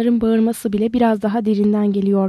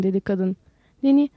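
usual speaking voice

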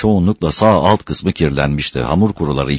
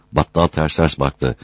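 usual speaking voice

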